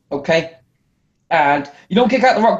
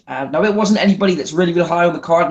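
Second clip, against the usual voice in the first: usual speaking voice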